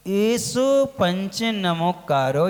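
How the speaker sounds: एसो said slowly with a clear short ए; slow careful एसो पंच नमोकारो; correct pronunciation. Shown as a demonstration. Monk teaching listeners to pronounce the mantra, slow and exact. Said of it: "अब वहाँ आता है".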